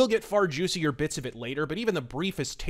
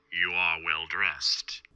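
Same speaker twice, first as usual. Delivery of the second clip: slow, low sinister stranger voice; a cold voice from the shadows; mysterious stranger; 8 semitones lower, slower than usual. Acted. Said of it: "the stranger's voice remarked".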